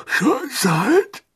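high, drowsy groan of a question; half-asleep, high-pitched mumble; sleepy voice. A narrator's reading. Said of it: "mit verschlafener Stimme".

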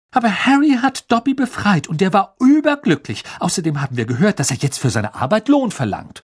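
put-on a clever girl pushing back quickly; eager schoolgirl protest. A narrator's reading. Narrator voices Hermine arguing.

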